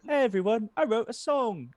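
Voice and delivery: high-pitched, naive musician voice; lightly hammed-up high impression. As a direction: announce it in a high, eager mock voice. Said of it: "where [name]'s like".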